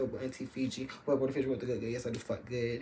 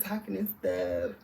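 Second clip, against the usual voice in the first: ordinary talk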